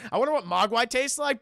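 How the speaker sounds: ordinary delivery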